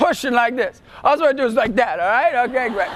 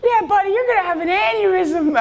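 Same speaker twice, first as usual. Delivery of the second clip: put-on high-pitched kid voice; 7 semitones higher than usual. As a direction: call out like the kid in the third row. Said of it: "He goes".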